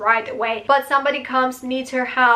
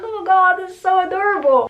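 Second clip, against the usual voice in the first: high, louder, delighted squeal of words; adoring gush; 7 semitones higher, 4 dB louder, faster than usual. Retold or imitated. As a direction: gush over something adorable. Gushing over a retold moment.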